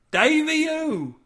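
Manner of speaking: high, drawn-out cry; calling out. A narrator's reading. Cade reacts to Davy staying behind, crying out. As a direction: call it out long and high.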